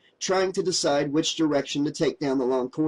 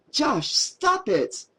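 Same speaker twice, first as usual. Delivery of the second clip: protesting; 11 semitones higher, much slower than usual. A narrator's reading. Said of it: "she protested".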